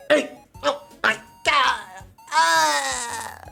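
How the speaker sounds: high, slow cry trailing into a wail; hammed-up swooning. Swooning over the panel.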